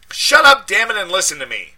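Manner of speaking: high, loud yell of his name. A narrator's reading. Narrator yelling into the phone at his friend. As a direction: yell to get his attention.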